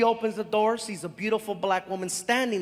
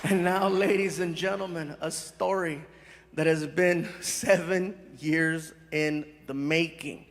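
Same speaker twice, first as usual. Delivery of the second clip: showman announcer; 5 semitones lower than usual. Acted. Host presenting his friend to the crowd, grand announcer build-up. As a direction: hype the guest like a host. Formal stage introduction.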